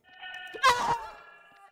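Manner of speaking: high, loud burst of laughter; sudden high cackle. Real, not acted. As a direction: burst out laughing at your own joke. Outburst after own joke.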